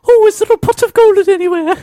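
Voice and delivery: high, loud campy Irish voice. Pretend. Playing [name] touring the haunted house, high and campy. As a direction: squeal it like an excited pop twin.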